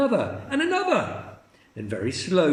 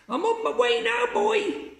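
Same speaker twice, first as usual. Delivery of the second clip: put-on very high old woman calling out. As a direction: call down gleefully to the boy. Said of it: "she called down to George".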